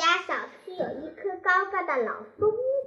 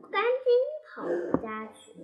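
ordinary delivery, nothing marked

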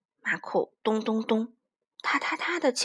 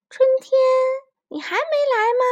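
much higher, loud call; 13 semitones higher, 7 dB louder than usual. Acted. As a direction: yell for spring. Kids shouting for spring.

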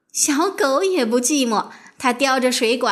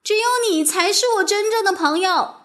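high, earnest storybook call; 8 semitones higher than usual. A narrator's reading. Girl calls out to her one true friend, high-pitched calling. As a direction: call out high to someone out of sight. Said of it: "麦吉娜大声喊".

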